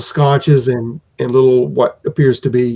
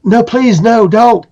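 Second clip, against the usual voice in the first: higher, louder anxious plea; pleading craftsman; 9 semitones higher, 4 dB louder, slower than usual. Acted. Craftsman begging the hesitant hero: higher anxious plea. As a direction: beg them not to pull away.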